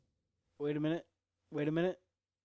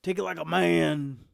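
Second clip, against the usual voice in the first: normal talking voice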